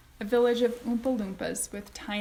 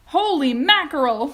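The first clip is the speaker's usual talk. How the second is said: higher, loud alarmed cry; cried out; 7 semitones higher, 12 dB louder than usual. A narrator's reading. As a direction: exclaim in shock. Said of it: "cried Mister T V".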